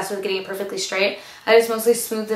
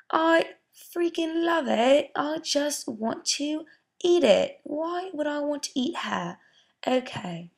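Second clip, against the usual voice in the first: high, slow, gushing in a put-on British accent; 6 semitones higher, slower than usual. Vlogger gushing over her fresh curls, in a put-on British accent. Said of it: "why am I talking in a British accent?".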